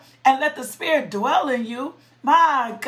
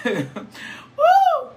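laughing whoop. Real, not acted. Preacher thankful not left a mess, laughs and whoops high. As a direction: laugh then a high joyful cry.

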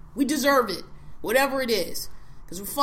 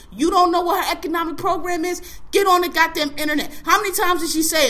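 louder and higher, fed up; angry shout; 4 semitones higher, 5 dB louder than usual. Real, not acted. Scolding voters who skipped the plan, angry shout. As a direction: yell at them in disbelief.

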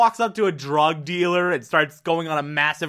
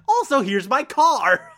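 carefree Chidi; 7 semitones higher, 4 dB louder than usual. Retold or imitated. Chidi voiced in retelling.

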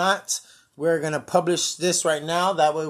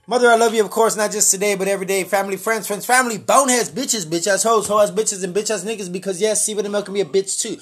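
radio announcer; 3 semitones higher, 6 dB louder than usual. Acted. Radio sign-off shoutouts.

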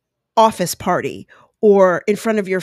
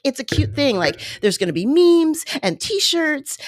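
normal talking voice

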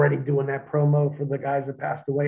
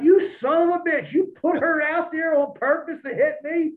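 angry outburst; 14 semitones higher than usual. Retold or imitated. Wrestler yelling at his friend for setting him up, angry outburst. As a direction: blow up at him, half laughing. Accusing Shane of planting the babysitter.

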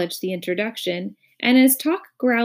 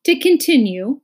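higher, louder, impatient resumption; 6 semitones higher, 7 dB louder than usual. A narrator's reading. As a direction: cut back in impatiently. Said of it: "continued the minister impatiently".

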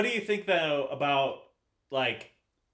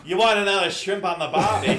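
loud, high, laughing fake Australian accent; a joke accent through laughter; mock Australian accent; 6 semitones higher, 11 dB louder than usual. Teasing a friend with a laughing fake Aussie accent. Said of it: "my Jamaican accent".

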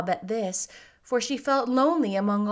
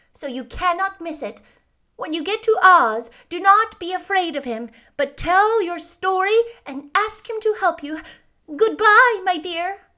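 put-on high, emphatic old lady voice; kindly old witch; 9 semitones higher, 7 dB louder than usual. A narrator's reading. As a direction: advise the child firmly, pitched up. Voices witch saying farewell.

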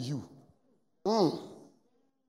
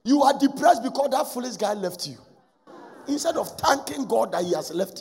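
higher, louder rebuke; loud scolding; 6 semitones higher, 6 dB louder than usual. Real, not acted. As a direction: call them out sharply. Preacher mocks the boyfriend craving.

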